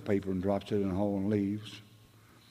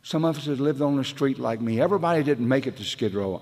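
ordinary talk, nothing marked

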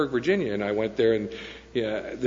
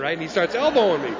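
ordinary delivery

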